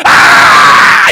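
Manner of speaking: one long, very loud mock bawl; imitated screaming baby. Pastor showing how his son cried instead, enormous long scream. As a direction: blast out an infant's cry like a roaring preacher. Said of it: "이러는 거야".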